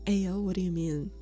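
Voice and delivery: ordinary delivery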